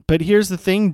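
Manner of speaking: normal talking voice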